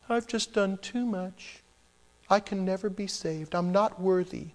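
hammed-up hopeless sinner; mock despairing sinner. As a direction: imitate a sinner wallowing. Mimicking despairing sinner to God.

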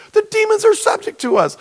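preacher mimicking the disciples bragging to Jesus; mock boastful disciples. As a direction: parody the disciples bragging like kids.

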